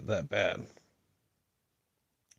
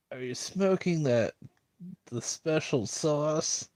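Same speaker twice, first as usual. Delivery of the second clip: higher, slowed-down incredulous jab; mocking disbelief; 3 semitones higher than usual. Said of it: "that's how I want to say that".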